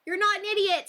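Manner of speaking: high, tender reading of a kind line; sweetly voiced kind viewer. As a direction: read it like a gentle reassurance.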